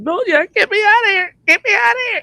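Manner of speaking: very high, frantic squeal to escape; panicked plea. Retold or imitated. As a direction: beg to get out of there, panicking high. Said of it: "I said".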